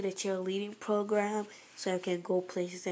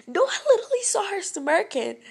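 much higher, loud cry; desperate protest; 10 semitones higher, 8 dB louder than usual. Retold or imitated. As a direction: cry it out in disbelief. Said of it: "And I was like".

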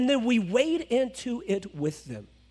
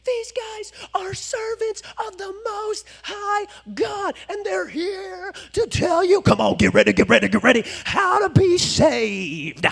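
mock slave girl; 8 semitones higher, 5 dB louder than usual. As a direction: ham up her preacher cry. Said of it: "she is saying all the right stuff".

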